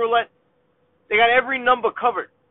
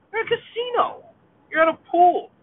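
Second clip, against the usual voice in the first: exasperated; 9 semitones higher than usual. Real, not acted. Ranting at jersey-wearing gamblers.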